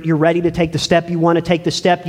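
usual speaking voice

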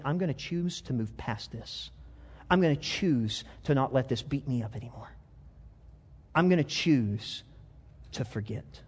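quiet resolve. Acted. Voicing a resolve to forget.

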